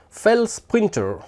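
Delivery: name read with a light English accent; mild English accent on the name. Shown as a demonstration. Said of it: "T'as vu un peu mon accent".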